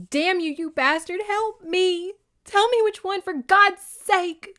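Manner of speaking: loud, high desperate screaming plea; acted hysteria. Woman begging pilot in falling helicopter, acted hysteria. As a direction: cry it out high and loud, stretching the ending. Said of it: "she sobbed hysterically".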